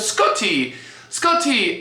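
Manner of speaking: high, playful imitation of a British friend; hammed-up British accent; bad British accent. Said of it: "I'm doing a terrible accent".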